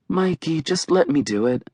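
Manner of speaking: gentle sisterly pleading, starting high; asking kinda nicely. A narrator's reading. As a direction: ask nicely, with worry underneath. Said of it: "She's asking kinda nicely for her".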